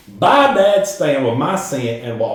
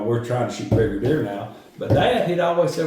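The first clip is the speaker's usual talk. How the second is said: ordinary talk, nothing marked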